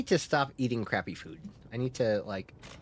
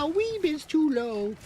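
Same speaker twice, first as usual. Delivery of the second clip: weeb said in a far high squeak; squeaky high voice. Shown as a demonstration. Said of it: "pitifully low".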